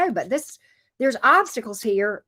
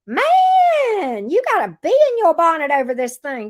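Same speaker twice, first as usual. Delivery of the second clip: stretched high, louder exclamation; exaggerated teasing; 7 semitones higher, 7 dB louder than usual. Real, not acted. Teasing an angry reader.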